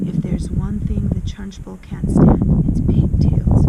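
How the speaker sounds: loud, slow schoolboy voice; a boy bursting with the news. A narrator's reading. Boy character explaining loudly.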